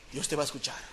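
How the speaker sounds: normal talking voice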